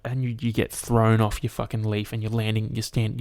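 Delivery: ordinary delivery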